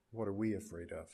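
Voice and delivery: hushed question. Acted. Soft repeated reflective question.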